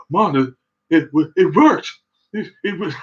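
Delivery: high, stammering excitement; blurting the news up high; excited stammer. Retold or imitated. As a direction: son calling his mother, stammering, excited. Son telling mom it worked.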